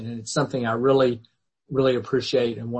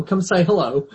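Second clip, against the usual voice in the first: higher, louder coaxing call with a laugh; playful laughing call; 7 semitones higher, 6 dB louder than usual. Real, not acted. Teacher calls Maggie over to greet the class, laughing call. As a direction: coax her in, laughing.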